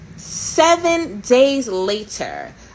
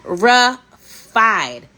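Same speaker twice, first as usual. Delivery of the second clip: Terrified said slowly and louder, each syllable drawn out; stretched emphatic terrified; 2 semitones higher, 5 dB louder, much slower than usual. Shown as a demonstration. Repeating it to her viewers, slow and emphatic. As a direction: lean into the camera and drag out Terrified. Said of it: "Let me zoom in".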